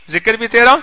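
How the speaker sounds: usual speaking voice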